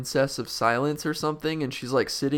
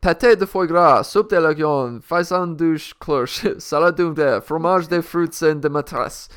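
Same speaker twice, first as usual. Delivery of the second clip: hammed-up French accent, louder, with laughs; French menu; 5 semitones higher, 8 dB louder than usual. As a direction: read the menu in mock French. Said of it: "Now this is in French".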